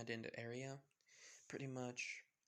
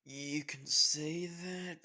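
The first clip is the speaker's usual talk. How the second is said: drawn-out sarcasm; 4 semitones higher, 11 dB louder, much slower than usual. Acted. Izuku bitter about the bike.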